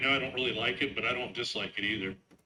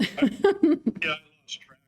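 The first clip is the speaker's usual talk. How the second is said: laughing; 11 semitones higher, 9 dB louder, slower than usual. Real, not acted. Laughs after being teased.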